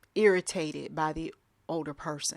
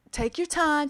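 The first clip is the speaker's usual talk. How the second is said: sugary coaxing; 8 semitones higher, 3 dB louder than usual. Retold or imitated. Young worker coaxing an elderly client, sugary high. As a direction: soothe her like a child. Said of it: "had to cover it up with".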